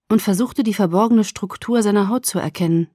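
plain reading voice

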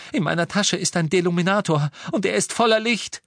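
high, rising excitement; urgent, breakthrough cry. A narrator's reading. Captive urgently reveals a solution.

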